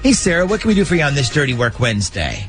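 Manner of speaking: normal talking voice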